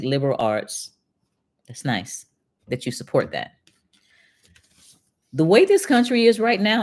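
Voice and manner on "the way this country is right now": emphatic. Real, not acted. Emphatic start of closing point.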